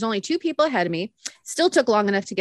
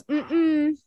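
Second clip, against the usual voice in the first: grandma's refusal; 5 semitones higher than usual. Retold or imitated. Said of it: "My grandma goes".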